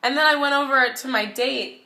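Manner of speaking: ordinary talk, nothing marked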